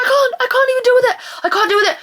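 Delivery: high-pitched, overwhelmed outburst; overwhelmed whine. Real, not acted. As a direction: whine as if you can't cope. Overwhelmed, on verge of crying.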